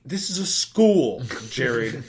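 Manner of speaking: high, loud, slow scolding; mock scolding principal. Assistant principal scolds him for smoking at school, high loud and slow. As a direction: drag out the scolding like an exasperated principal.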